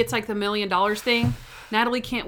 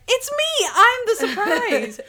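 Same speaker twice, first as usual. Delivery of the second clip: much higher, slow and sing-song, playful; coy Natalie voice; 14 semitones higher, 4 dB louder, slower than usual. Retold or imitated. Assistant teasing her boss in a very high, coy voice. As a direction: present yourself as the gift, coyly and very high. Natalie announcing herself as the surprise.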